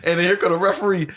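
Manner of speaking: ordinary talk, nothing marked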